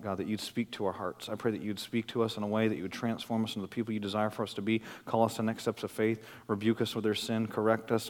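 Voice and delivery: soft, low pastoral prayer. Acted. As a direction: pray quietly over the congregation.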